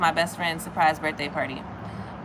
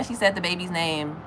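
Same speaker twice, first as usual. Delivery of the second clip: normal talking voice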